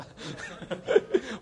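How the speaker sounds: high burst of laughter; laughing. Real, not acted. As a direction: laugh out, amused. Congregation joke, preacher laughs.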